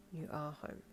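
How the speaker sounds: soft, drowsy rasp; sleepy rasp. A narrator's reading. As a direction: murmur it half asleep. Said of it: "Darby rasped sleepily".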